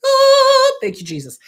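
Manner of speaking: a sudden high, loud Ah of delight; relieved joyful cry. Retold or imitated. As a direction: burst out with relief. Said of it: "I'm like".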